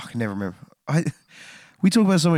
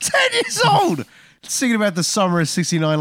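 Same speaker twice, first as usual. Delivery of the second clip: outraged disbelief; 11 semitones higher, 5 dB louder than usual. Real, not acted. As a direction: blurt it out in disbelief. Outraged at the songwriter's age.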